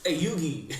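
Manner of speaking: high, laughing mock Staten Island accent; badly faked Staten Island call-out; playful Staten Island cartoon voice. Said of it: "Trying to call Staten Island".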